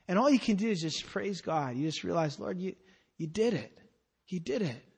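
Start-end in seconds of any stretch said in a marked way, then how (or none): 2.4-4.8 hushed thanks. Retold or imitated. Grateful prayer to God.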